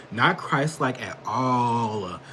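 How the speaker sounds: Not Christ-like at all, slowed right down; slow emphatic repeat. Real, not acted.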